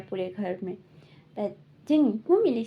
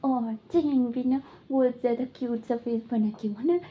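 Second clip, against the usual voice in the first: ordinary talk, nothing marked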